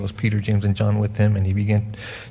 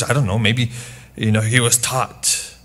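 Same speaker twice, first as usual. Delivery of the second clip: normal talking voice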